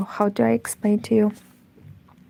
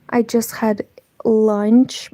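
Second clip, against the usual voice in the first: usual speaking voice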